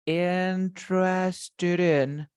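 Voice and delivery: "interested in" very slowly stretched and linked; "interested in" in slow motion, no break between the words. Shown as a demonstration. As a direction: draw out "interested in" as one joined word. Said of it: "When you link the word".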